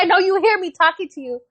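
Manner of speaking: put-on high-pitched confronting a child who ignores you; retold exasperated mom scolding; exasperated mother.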